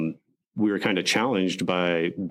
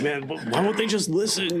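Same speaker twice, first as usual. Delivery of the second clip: pitched-up exasperated cry of frustration; frustrated outburst; 10 semitones higher than usual. Retold or imitated. Soldier venting at events nobody listens, exasperated and high. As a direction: throw the question up in mock despair. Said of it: "I'm like".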